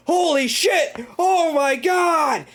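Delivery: high, slow, stretched-out mock shriek; horrified scream. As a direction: imitate someone wailing in disbelief. Retold hypnotist reacting in horror.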